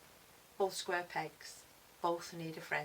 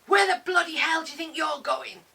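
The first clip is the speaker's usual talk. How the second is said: angry father's loud, raised bellow; booming shout; 9 semitones higher, 12 dB louder than usual. A narrator's reading. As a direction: boom it after a runaway child. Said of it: "a voice behind me booms".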